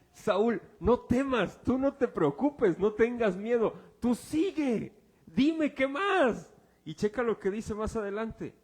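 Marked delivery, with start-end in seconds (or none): 0.1-6.5 louder, higher, coaxing king; hammed-up soothing of the medium; mock reassuring king. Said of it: "el rey le dijo". (el rey Saúl tranquiliza a la médium, burlón, más fuerte y agudo)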